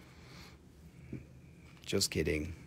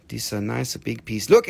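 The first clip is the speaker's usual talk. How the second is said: usual speaking voice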